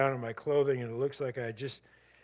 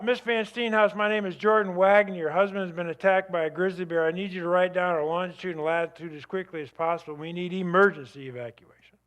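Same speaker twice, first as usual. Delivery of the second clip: urgent emergency call; 5 semitones higher, 7 dB louder than usual. Retold or imitated. Friend's urgent call to the wife.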